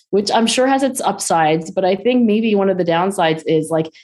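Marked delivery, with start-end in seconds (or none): none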